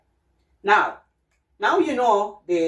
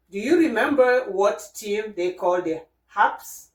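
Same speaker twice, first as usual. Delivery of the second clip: ordinary talk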